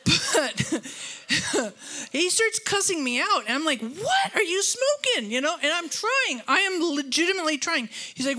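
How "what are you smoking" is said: high-pitched, incredulous challenge; indignant comeback. Retold or imitated. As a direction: fire it back in indignant disbelief. Retold parking lot quarrel.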